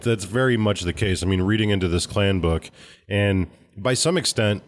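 normal talking voice

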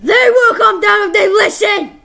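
high, loud holler into the microphone; playful full-voiced shout; yelling. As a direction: yell it back right into the mic. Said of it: "You just like yelling into this microphone".

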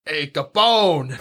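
drawn-out mock Chicago accent; cartoonish Chicago greeting. Shown as a demonstration. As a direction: call out slowly in a hammed-up Chicago accent. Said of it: "Chicago accent".

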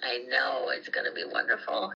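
almost whispered, moved; hushed whisper. Real, not acted. Mother moved, near tears.

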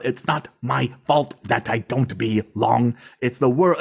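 slow, spelled-out delivery; over-enunciated. Retold or imitated. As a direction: spell it out like to a child. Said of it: "speaking to them like they're a child".

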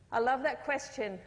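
usual speaking voice

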